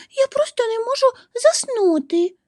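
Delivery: very high-pitched little squirrel voice; sighing squirrel. A narrator's reading. Squirrel cannot fall asleep, high sighing squeak. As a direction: squeak out a small, sighing complaint. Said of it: "зітхнула вона".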